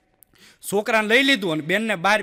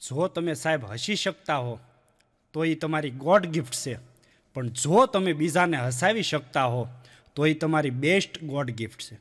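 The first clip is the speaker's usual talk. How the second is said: softer and lower, a quiet truth for the audience; sincere aside; 5 semitones lower, 5 dB quieter than usual. Real, not acted. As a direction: drop the joke voice and say it gently. (શાંત, નિખાલસ સ્વરે)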